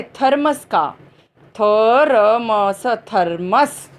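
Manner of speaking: थर्मस drawn out slowly, syllable by syllable; slow modelled word. Shown as a demonstration. Teacher to children, slowly modelling थर्मस. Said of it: "teacher models word for children".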